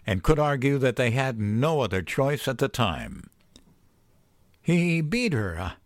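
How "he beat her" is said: high, emphatic "beat her"; insisting. A narrator's reading. Arguing mother's case to the man, insistent "He beat her". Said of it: "I insisted".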